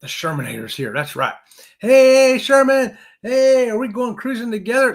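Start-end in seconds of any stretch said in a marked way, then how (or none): 1.8-4.9 high, loud, drawn-out hey; big high-pitched shout-out; calling out greeting. Real, not acted. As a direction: hail an arriving friend at full voice. Hailing a viewer who joined.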